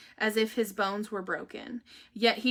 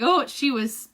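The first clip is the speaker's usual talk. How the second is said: normal talking voice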